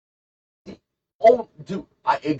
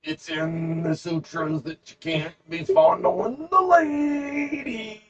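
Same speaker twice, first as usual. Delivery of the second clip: high, slow, whiny lecturing voice; a mock-pious scold getting shrill at the end; hammed-up prissy monk wagging a finger; preachy scolding monk; 10 semitones higher, slower than usual. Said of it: "Dave would be like".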